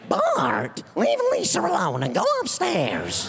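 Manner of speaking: high, strained cartoon mum scolding; Marge Simpson. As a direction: scold the kid in a put-on cartoon mother voice. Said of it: "You had Marge over here".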